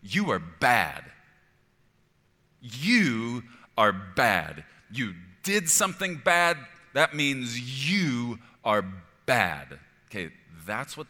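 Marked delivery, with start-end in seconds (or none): 0.0-9.8 loud, drawn-out harsh accusation; voice of condemnation. Acted. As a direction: pronounce a slow, booming verdict of guilt. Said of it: "The voice of condemnation says this".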